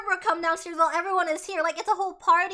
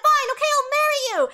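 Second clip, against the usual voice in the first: much higher, loud put-on voice; reluctant giving in; 10 semitones higher, 8 dB louder than usual. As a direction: cave in with a mock shriek. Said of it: "And she's like".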